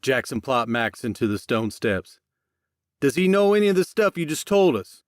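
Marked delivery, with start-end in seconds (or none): 3.0-5.0 high and loud, angry; furious question. A narrator's reading. Jackson confronts Max, loud and angry. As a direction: keep hammering the question. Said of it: "Jackson demanded".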